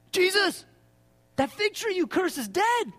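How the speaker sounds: high, wide-eyed report to Jesus; amazed disciples. Acted. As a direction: gasp out the news like a stunned follower. Disciples report dead fig tree.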